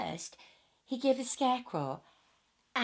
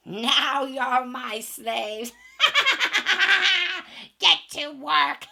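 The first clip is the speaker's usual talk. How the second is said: loud, high gloating cackle; wicked witch gloating at her prisoners; wicked witch cackling; 7 semitones higher, 11 dB louder, slower than usual. Acted. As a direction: cackle over your new slaves.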